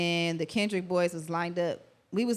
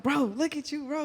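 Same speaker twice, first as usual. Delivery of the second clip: higher, amazed hype at her friend; excited hype; 8 semitones higher than usual. Retold or imitated. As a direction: lightly gush over your homegirl's moment. Hyping homegirl at watch party.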